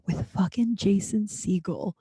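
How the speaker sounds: hushed, conspiratorial whisper; whispered reveal. Real, not acted. Whispering to the audience as the celebrity walks up. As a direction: drop to a whisper for the big reveal.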